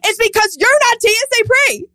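high mock-exasperated complaint; exasperated inner rant. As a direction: let the exasperation ring out. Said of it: "I was like".

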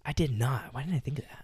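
breathy whisper to herself; whispered aside. Real, not acted. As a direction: drop to a whisper, half to yourself. Muttering to herself mid-story.

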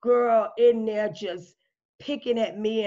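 usual speaking voice

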